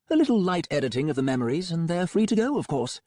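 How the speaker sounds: breezy, untroubled villain doctor; Melmoth voice. A narrator's reading.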